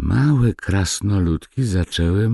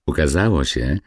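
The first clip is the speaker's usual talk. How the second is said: plain reading voice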